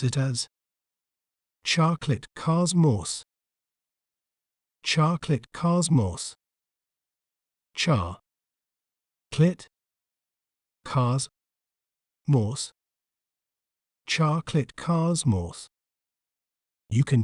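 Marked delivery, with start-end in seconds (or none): 1.6-6.3 American pronunciation of "chocolate cosmos", open "ah" vowels; American accent. Shown as a demonstration. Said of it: "In American accent". 7.7-12.7 American "chocolate cosmos" broken into isolated syllables, open "ah"; American accent, syllable by syllable. Shown as a demonstration. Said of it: "In American accent". 14.1-15.7 American pronunciation of "Chocolate cosmos", open "ah" vowels; American accent. Shown as a demonstration. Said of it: "In American accent".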